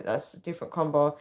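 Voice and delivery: ordinary delivery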